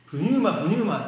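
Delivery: put-on high, loud childlike chant; toddler's word. Father imitating his little daughter, childlike. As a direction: imitate a two-year-old's babbling. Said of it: "プニューマって話し出したんですね".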